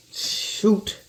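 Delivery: breathy, voiceless little shoot; a whispered curse while the onions sting. Real, not acted. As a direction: hiss it out under your breath, eyes stinging. Eyes watering from onions.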